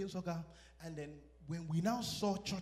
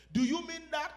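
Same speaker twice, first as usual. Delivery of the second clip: questioning tone; 10 semitones higher, 7 dB louder than usual. Acted. Preacher acts out asking questions.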